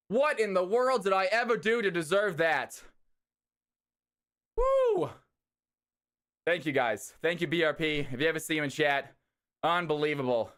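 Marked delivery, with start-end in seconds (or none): 4.5-5.1 high whooping cheer; excited cheer. Real, not acted.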